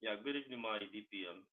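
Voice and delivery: very quiet, faint greeting; too low to hear. Real, not acted. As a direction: greet them far too softly. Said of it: "It's too low".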